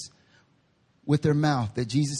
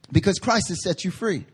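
normal talking voice

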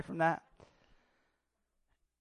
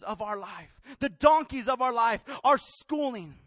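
raised, insistent exhortation; impassioned preaching; 8 semitones higher, 10 dB louder than usual. Real, not acted. Preacher telling listeners God is calling, impassioned exhortation. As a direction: build a driving, repeated call to the congregation.

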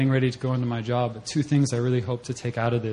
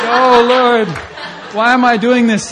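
much higher and louder, through laughter; laughing exasperation; 11 semitones higher, 10 dB louder than usual. Retold or imitated. Teller recalling his embarrassed prayer mid-blessing, laughing and high. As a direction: laugh at your own awkwardness. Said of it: "And I was like".

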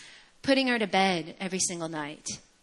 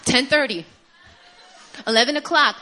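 ordinary talk, nothing marked

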